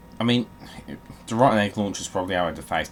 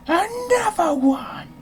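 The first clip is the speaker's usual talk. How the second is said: much higher, slow, stretched-out hype call; mock hype shout; 5 dB louder, much slower than usual. Gamer mocks the game throwing more at him, hype shout. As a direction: belt it out like a hype man announcing. Game keeps piling on enemies.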